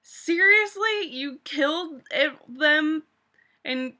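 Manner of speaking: high, loud, drawn-out "seriously?"; can't believe what she's hearing; stretched incredulous cry; exasperated disbelief. Retold or imitated. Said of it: "And it's like".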